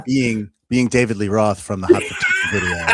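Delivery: laughing while telling the joke. Real, not acted. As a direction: push the words out through laughter.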